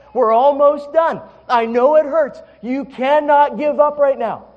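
high, loud workout-coach voice; urgent encouragement. Retold or imitated. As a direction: imitate a wife pushing you through the last reps. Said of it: "encourage me like crazy".

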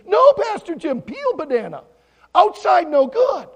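slow, high, shouted warning; screaming children. Retold or imitated. Children warning the pastor about the peel, screaming. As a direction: holler each word like panicked kids. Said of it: "they all started screaming".

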